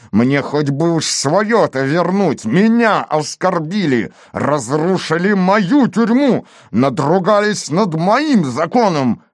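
high, drawn-out outrage; indignant tirade. A narrator's reading. Police chief rounding on Torres over his jail, indignant. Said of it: "накинулся на него".

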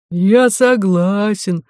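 slow, drawn-out monster growl; narrated monster voice, heavily stretched words; monster's growl. A narrator's reading. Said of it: "прорычало чудовище".

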